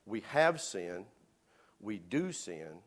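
slow, stress on have and do; preacher driving home the verse, slowly; slow emphatic paraphrase. Shown as a demonstration. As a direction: press each word home. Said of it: "It's saying".

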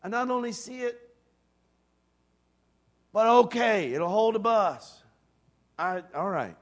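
hesitant assent. Shown as a demonstration. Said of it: "receive that and say".

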